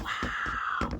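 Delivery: breathy whispered wow; whispered awe. Real, not acted. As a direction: let it out in wonder. Awed aside.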